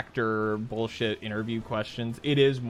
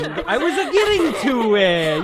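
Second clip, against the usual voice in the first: laughing; 13 semitones higher, 5 dB louder than usual. Real, not acted. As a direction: protest through laughter. Laughing protest.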